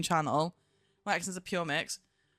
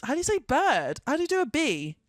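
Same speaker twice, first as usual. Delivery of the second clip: ordinary talk, nothing marked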